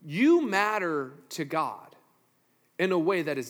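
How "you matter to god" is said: normal talking voice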